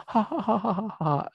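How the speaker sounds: drawn-out put-on "hahaha"; mock sudden laugh. Shown as a demonstration. Teacher imitates sad patient suddenly laughing, mock laugh. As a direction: burst into slow, fake laughter. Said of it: "tiba ketawa".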